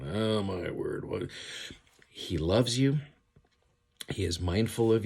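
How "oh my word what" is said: slow, drawn-out mock dismay. Imitating a Lord who is not like that, mock dismay. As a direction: draw it out like a head-shaking groan of disbelief. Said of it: "shaking his head going".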